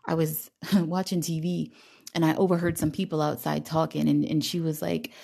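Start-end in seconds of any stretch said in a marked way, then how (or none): none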